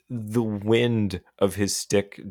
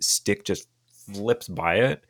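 ordinary delivery

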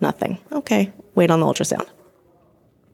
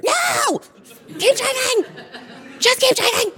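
high, frantic yell breaking into laughter; frantic scream. Retold or imitated. To husband at the wheel, begging him not to stop: frantic high scream. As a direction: scream at him to keep driving.

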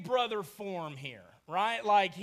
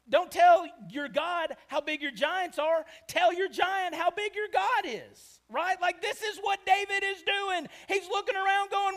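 usual speaking voice

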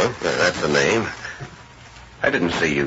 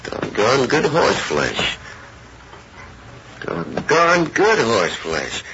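slow, higher-pitched appreciative drawl; drawn-out admiration; 8 semitones higher, slower than usual. Acted. Ranch hand looking over a stranger's horses, slowly admiring. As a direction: linger on each word, sizing up fine horses.

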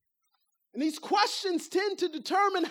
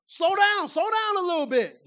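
loud, higher nagging at the driver; backseat driver yelling; 3 semitones higher, 5 dB louder than usual. Retold or imitated.

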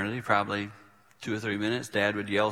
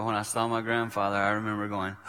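ordinary talk

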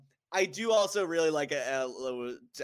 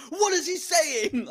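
confused dad; 12 semitones higher, 5 dB louder, slower than usual. Acted. Confused dad voiced in retelling.

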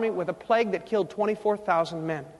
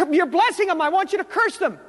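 mock furious, pitched-up complaint; exasperated outburst, higher than usual; 6 semitones higher than usual. Furious king scolding the prophet for blessing them, exasperated. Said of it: "He was furious".

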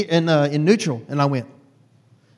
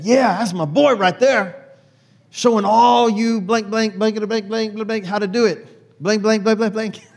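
proud grandpa bragging; 5 semitones higher, 3 dB louder than usual. Retold or imitated. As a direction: crow over the boy to everyone. Pawpaw bragging to field workers.

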